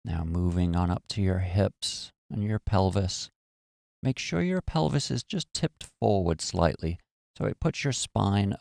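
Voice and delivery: calm, even meditation voice with long pauses; guided meditation. Acted. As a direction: guide them gently into relaxation, phrase by phrase.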